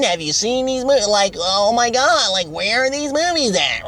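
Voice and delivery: high, whiny impatient fan voice; nagging fans. Retold or imitated. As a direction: pester like a demanding fan. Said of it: "heard from dozens of people".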